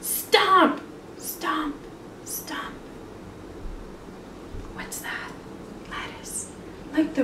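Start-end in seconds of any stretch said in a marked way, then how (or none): 0.0-2.7 stomp words fading to a whisper. Acted. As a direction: let the stomps walk off into the distance. Bear stomping, fading away.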